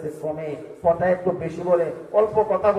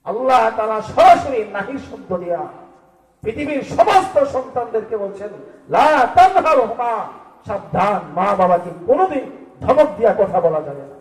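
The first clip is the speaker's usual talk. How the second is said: loud, raised proclamation of a ban; forceful preaching; 4 semitones higher, 5 dB louder than usual. Acted. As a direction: drive the warning home with force. Preacher proclaims God's prohibition.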